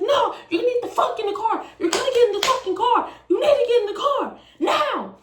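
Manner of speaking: high, loud mock shouting; angry ordering. Boyfriend yells at her again.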